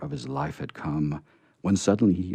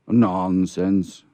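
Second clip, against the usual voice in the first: brisk cry; 7 dB louder than usual. Acted. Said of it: "cried Santa Claus".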